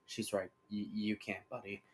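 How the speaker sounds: low, soft aside; slight response. A narrator's reading. Aaron agrees with Twilight to his hostage, low and soft. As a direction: drop low and quiet. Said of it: "Aaron responds with a slight".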